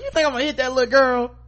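mock indignant yell. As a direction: fire it back in disbelief. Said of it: "She's like".

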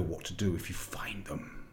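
unvoiced sinister witch whisper. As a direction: breathe the threat, barely voiced. Witch's sinister last order.

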